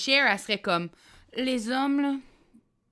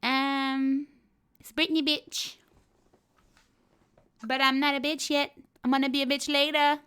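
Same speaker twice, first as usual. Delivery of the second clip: put-on higher, slow girlish pop-star voice; breathy teen pop star; 7 semitones higher, slower than usual.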